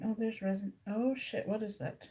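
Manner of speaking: ordinary delivery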